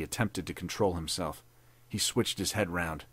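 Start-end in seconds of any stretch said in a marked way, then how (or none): none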